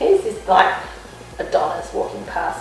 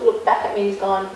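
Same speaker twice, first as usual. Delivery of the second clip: ordinary talk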